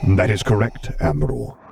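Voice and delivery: calm, commanding dark lord; Vader's voice. A narrator's reading.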